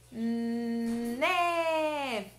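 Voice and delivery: NÉ with a held N opening into a long open É; nnnééé, drawn out; blending letter sounds. Shown as a demonstration. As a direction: hum the N, then swell into "Né". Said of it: "vamos falar o sonzinho da letrinha N".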